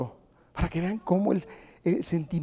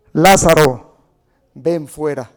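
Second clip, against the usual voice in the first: booming command to the dead man; loud call; 3 semitones lower, 12 dB louder, much slower than usual. Acted. Preacher booming Jesus's call to Lazarus. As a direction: call him out of the tomb at full voice. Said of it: "Clamó a gran voz".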